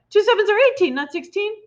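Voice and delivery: put-on high boy's eager wrong answer; boy blurts Two sevens are eighteen; wrong answer cried. A narrator's reading. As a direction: sure of the wrong sum. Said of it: "he cried".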